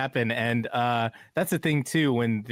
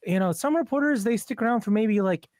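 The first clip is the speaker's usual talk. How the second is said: much higher, lightly voiced engineer; a hint of the engineer's voice; quoting the engineer; 7 semitones higher than usual. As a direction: imitate the studio engineer mid-compliment. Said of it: "He said".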